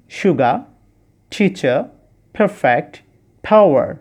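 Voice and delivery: each word said carefully with a pause between; model pronunciation. Shown as a demonstration. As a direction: read the list slowly as a model. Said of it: "আবার শব্দগুলো শুনে নিই". (প্রতিটি শব্দ আলাদা করে শুদ্ধ উচ্চারণে)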